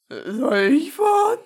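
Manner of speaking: put-on high, loud old grandpa; old man voice. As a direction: ask like a doddery old man. Redoes grandpa line in voice.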